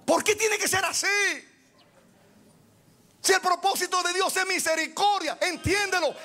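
a fired-up preacher pleading with the church; fiery preaching. Real, not acted. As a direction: raise the voice and drive each question home. Preaching at full force.

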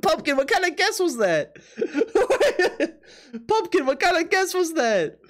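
high-pitched, laughing incredulous question; laughing disbelief. Real, not acted. Streamer teases Pumpkin over a wild guess, laughing. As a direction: burst out at an absurd guess.

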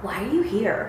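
very soft, stunned question; quiet disbelief. Retold or imitated. Imitating boyfriend finding her in his room, hushed disbelief. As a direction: imitate someone baffled, barely voicing it.

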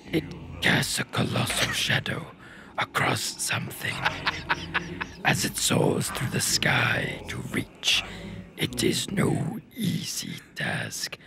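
slow, whispered godlike intoning; Dormin's voice. Acted. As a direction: intone it like an ancient spirit. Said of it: "who's doing, uh, Dormin's voice".

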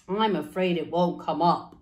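low, gruff-leaning dad voice; father's voice. Acted. Father doubting the seed, deep dad voice. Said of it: "That's what fathers sound like".

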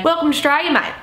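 hammed-up Aussie welcome, "Australia" shortened to "Straya"; Aussie slang welcome. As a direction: greet like a proud Aussie. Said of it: "So we say".

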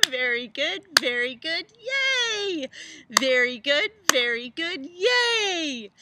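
loud high cheer. Real, not acted. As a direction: cheer them on, loud and bright. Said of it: "cheering after exercise".

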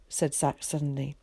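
ordinary delivery

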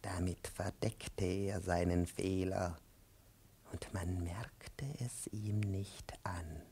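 low, soft, half-whispered murmur; slow, confiding undertone; hushed aside. Acted.

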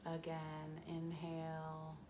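calm, unhurried guiding into the breath; guided meditation. Acted.

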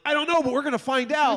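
high, excited retold outburst; giddy dad. Dad matching daughter's excitement.

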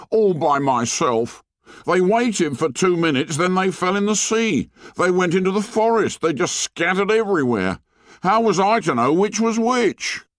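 put-on high-pitched boy defending himself; indignant protest. A narrator's reading. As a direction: protest your innocence, high and aggrieved. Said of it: "cried Piggy indignantly".

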